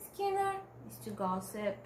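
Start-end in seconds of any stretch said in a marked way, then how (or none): 0.1-0.5 "Skinner" popped out much higher as a quoted word; quoting the slang word. Shown as a demonstration. Student shows off the slang word, pitched high. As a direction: lift "Skinner" up high to show it off. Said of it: "So they are".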